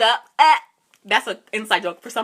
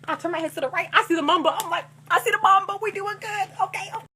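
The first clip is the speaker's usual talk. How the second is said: high-pitched, excited play-by-play; 12 semitones higher, 4 dB louder than usual. Retold or imitated. New driver reporting landmarks to mom, excited. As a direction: re-enact giddy confidence, pitched way up. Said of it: "last time I was excited".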